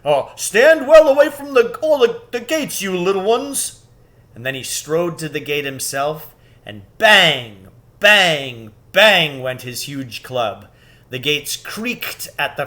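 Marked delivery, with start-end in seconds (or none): 0.0-3.8 high, loud giant voice; giant's warning. A narrator's reading. Giant warning the children off the gates, loud. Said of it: "Giant Rumblebuffin". 7.0-10.7 each bang punched loud and slow; pounding bangs. A narrator's reading. Narrator sounding the club blows, loud. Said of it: "narrator stresses the blows".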